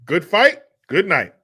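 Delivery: emphatic quoted sign-off, starting high and loud; show catchphrase. Acted. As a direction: throw out the sign-off like a TV host prompting fans. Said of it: "Come on, nineties people".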